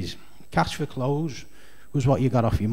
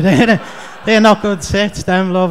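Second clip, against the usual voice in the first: laughing put-down; 4 semitones higher, 7 dB louder than usual. Retold or imitated. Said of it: "and you're thinking".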